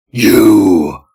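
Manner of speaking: loud growling monster cry; throaty growl. Acted. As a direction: growl it like a beast. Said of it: "sounded like a throaty growl".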